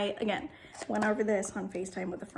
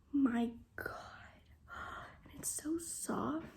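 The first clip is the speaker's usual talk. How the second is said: awed whisper; 7 dB quieter than usual. Real, not acted.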